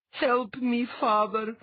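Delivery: put-on high, loud pleading girl; crying out for help. A narrator's reading. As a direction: beg your father to save you. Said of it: "she cried out unto the watching Althalos".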